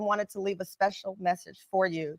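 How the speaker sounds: Southern pronunciation creeping in; southern accent. Real, not acted. Host to guest about a message left, slight southern accent. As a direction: let a Southern accent slip into it. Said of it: "That's my southern accent".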